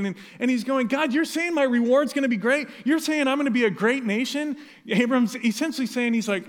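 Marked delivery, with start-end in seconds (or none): 0.9-4.6 higher, louder incredulous frustration; frustrated. Retold or imitated. Abram pushing back at God's promise, frustrated. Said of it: "And so Abram's frustrated".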